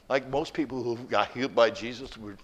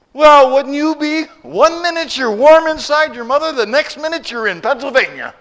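joking dad; 13 semitones higher, 14 dB louder than usual. Retold or imitated. New dad explaining the baby's anger, higher and louder. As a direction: land the punchline as the new dad. Dad's punchline.

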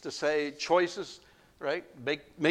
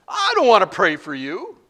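1 mock reluctance; 6 semitones higher, 11 dB louder than usual. Preacher voicing his reluctance.

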